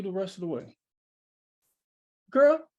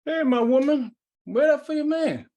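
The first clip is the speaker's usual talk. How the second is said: calling out; 3 semitones higher, 6 dB louder than usual. Acted. Mad calls to Lena from car.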